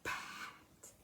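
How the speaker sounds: Pat whispered, no voice; whispered sound-out. Shown as a demonstration. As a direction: breathe the word Pat out as a hint. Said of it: "add that p sound".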